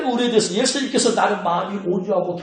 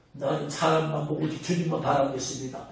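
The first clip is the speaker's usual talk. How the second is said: pious quote; 5 semitones lower, 7 dB quieter than usual. Retold or imitated. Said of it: "영성 고장 되니까".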